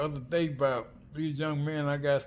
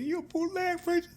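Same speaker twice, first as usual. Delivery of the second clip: squeaky-high mocking question; mock challenge. Retelling the bootleg preacher line from the funeral, mock challenge.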